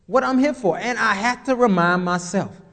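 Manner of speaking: ordinary talk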